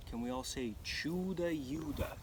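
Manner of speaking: plain reading voice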